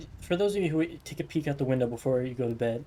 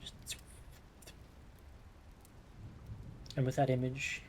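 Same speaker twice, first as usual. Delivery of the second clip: game master narration; 2 semitones lower, 5 dB quieter than usual. Acted. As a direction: paint the sky gently, unhurried. GM describes night sky scene.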